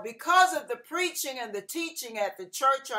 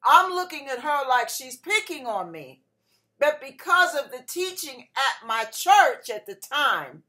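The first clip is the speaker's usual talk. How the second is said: ordinary delivery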